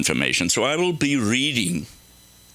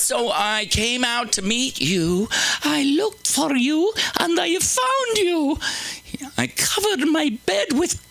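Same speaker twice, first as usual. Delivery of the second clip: high, hammed-up seductress; seductive woman. Comic voicing the seductive woman from Proverbs. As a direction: coo it like a temptress.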